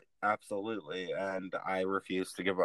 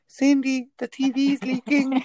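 high, loud Brenda voice; Brenda impression; 7 dB louder than usual. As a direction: squeal it like Brenda from Scary Movie. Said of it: "Brenda's like".

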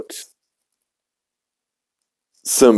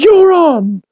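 high, louder cry; Stewie accepting; 4 dB louder than usual. Acted. Stewie taking Brian's bet, high and loud. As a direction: fling it out high as the challenge is taken. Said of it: "Stewie's like".

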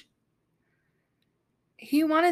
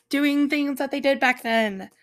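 normal talking voice

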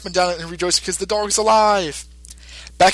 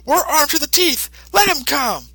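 much higher, swaggering thug voice; cocky thug; 12 semitones higher than usual. Retold or imitated. Gunman brushing off his partner's fear, in a much higher cocky voice. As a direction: brag like a gun-happy goon. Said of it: "And he says".

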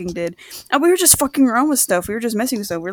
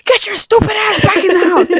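mom yelling; 9 semitones higher, 4 dB louder than usual. Retold or imitated. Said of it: "she's like".

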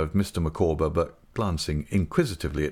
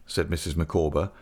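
ordinary delivery

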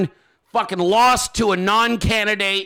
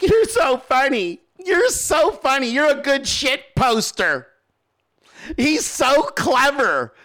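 high, gushing fangirl voice; mock the fawning fans; high gushing fanboy, a bit slow at the end; 5 semitones higher than usual. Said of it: "you're out here like".

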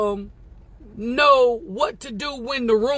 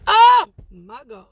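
excited exclamation; 3 semitones higher, 9 dB louder than usual. Real, not acted. Overcome by own words.

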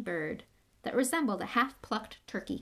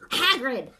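"Hagrid!" called sharply, much louder; loudly; 5 semitones higher, 10 dB louder than usual. A narrator's reading. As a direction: cut him off with a loud "Hagrid!". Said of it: "said Dumbledore loudly".

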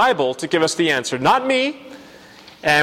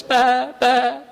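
higher, steady answering bleat, lightly voiced; calm the lamb down as its mother; mother sheep bleat; 8 semitones higher than usual. Mother sheep reassuring her lamb, lightly voiced bleat.

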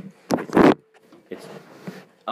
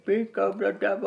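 Bane impression; 11 semitones higher than usual. Shown as a demonstration. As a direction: growl it like Bane. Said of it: "That was my Bane impression".

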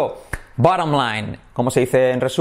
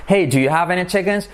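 higher, linked casual question; natural asking; 4 semitones higher than usual. Shown as a demonstration. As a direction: call "Hey" and run the question together. Natural acted version of slow model.

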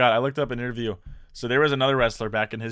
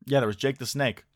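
usual speaking voice

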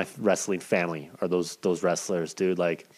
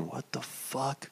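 slow, very soft mock disbelief; stunned hush; 2 semitones higher, 12 dB quieter, much slower than usual. Kids watching a man get choked, stunned and hushed. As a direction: imitate kids aghast under their breath.

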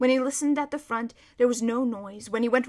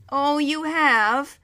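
very slow, drawn-out words; louder, savouring reply; knowing horse; 4 semitones higher, 7 dB louder, much slower than usual. A narrator's reading. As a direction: linger on each word as the horse. Horse answers the excited boy.

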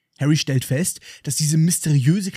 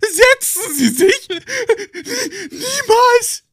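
very high, loud, slow wailing; Dobby wailing in exaggerated tears; wailing elf; 4 dB louder, much slower than usual. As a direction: howl it like a heartbroken elf. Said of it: "Er jammert".

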